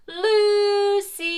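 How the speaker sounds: called shout. Shown as a demonstration. Mother Goose shows children how to shout for Lucy. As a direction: call out her name loud. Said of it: "everyone will shout".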